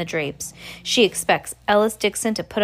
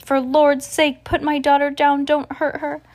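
put-on a mother begging the gunman to free her child; pleading cry; 9 semitones higher, 6 dB louder than usual. A narrator's reading. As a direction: beg for your daughter's life. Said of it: "Mama cries".

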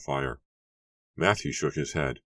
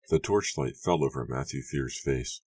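ordinary delivery

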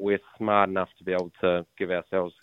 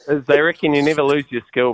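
usual speaking voice

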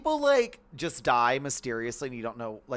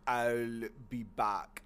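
put-on slow, deep Austrian accent; 4 semitones lower, 5 dB quieter, much slower than usual. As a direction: stretch every word, Arnold style. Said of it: "I have to do it slow to really get all the, like, the mouth movements properly".

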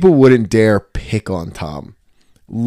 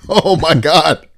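oh my god; 7 semitones higher, 5 dB louder, slower than usual. Retold or imitated. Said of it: "And I'm like".

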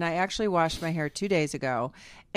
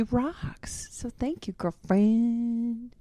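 whispering; 3 semitones higher, 4 dB quieter, slower than usual. Real, not acted. Thanking her hairdresser.

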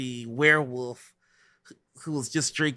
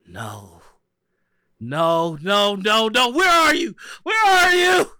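frantic yelling; 8 semitones higher, 7 dB louder than usual. Acted. Masked gunman lost in the labyrinth, frantic yelling. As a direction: escalate into desperate high shouting.

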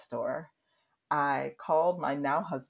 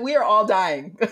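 loud, higher, emphatic wail; mock breakdown; 5 semitones higher, 12 dB louder than usual. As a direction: declare it dramatically, leaning on "are". Repeats breakdown line with emphasis.

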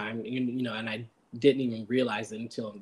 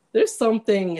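laughing quote; 9 semitones higher, 5 dB louder than usual. Retold or imitated. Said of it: "I was like".

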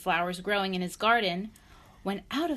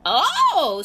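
loud, high startled oh; alarmed squeak; 10 semitones higher, 14 dB louder than usual. A narrator's reading. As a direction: squeal as if suddenly tickled. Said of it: "squeaked Mister Grumpy in alarm".

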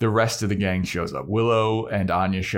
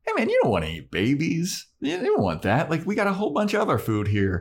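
higher, quick pleading voice; Xander; 7 semitones higher, faster than usual. Retold or imitated. Talking to the troll about babies, high coaxing voice. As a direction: talk him out of it, pitched up and hurried. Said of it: "Xander goes up to the troll".

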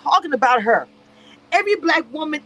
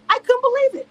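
shocked disbelief; 9 semitones higher, faster than usual. Real, not acted.

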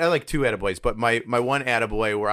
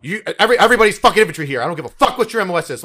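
drill sergeant tirade; 7 semitones higher, 8 dB louder than usual. Retold or imitated.

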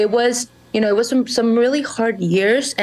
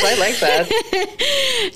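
laughing; 13 semitones higher, much slower than usual. Real, not acted.

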